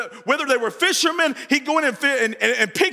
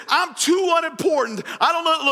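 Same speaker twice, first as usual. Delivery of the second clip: mock lament; 5 semitones higher than usual. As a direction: wail it like a small man begging off. Preacher voicing Zacharias's lament.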